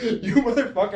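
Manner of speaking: high, loud retold swearing; mock exasperated cursing. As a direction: swear at the prankster in mock outrage. Acted cursing at giggling opponent.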